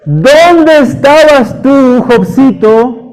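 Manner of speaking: mock-stern God questioning Job; God's voice. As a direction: boom down at little Job, slowly. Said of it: "¿Qué le dice Dios?".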